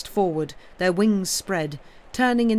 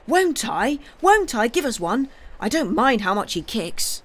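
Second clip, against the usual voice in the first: high, loud, bursting with eagerness; eager boy; 5 semitones higher, 5 dB louder than usual. Acted. Pip begging Pat to give him a duck, high and thrilled. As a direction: jump at the chance, voice leaping up.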